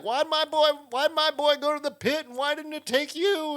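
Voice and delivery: hammed-up Southern accent, a mother's accusing cry; Southern mother's wail. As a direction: wail it like a grieving Southern mom. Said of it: "there's a Carolina accent".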